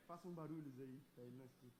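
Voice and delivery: very low, barely audible murmur. Shown as a demonstration. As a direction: speak so the distant listener can't hear. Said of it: "falar mais baixo". (falando baixinho, quase inaudível)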